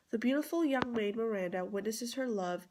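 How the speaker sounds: ordinary talk, nothing marked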